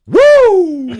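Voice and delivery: piercing high shout from the crowd; audience whoop. Retold or imitated. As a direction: whoop out alone in dead silence. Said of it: "one person goes".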